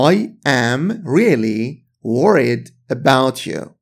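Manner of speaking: slow, "I am really worried about you" with each word apart; slow careful reading. Shown as a demonstration. Teacher models the full slow form for students, slow and careful. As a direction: lay out every word for learners.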